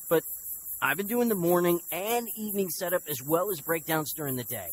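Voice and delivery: high-pitched, insistent complaint; aggrieved confrontation. Acted. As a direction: press your grievance against your foster father. Acted confrontation with foster father.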